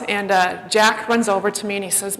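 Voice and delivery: ordinary talk, nothing marked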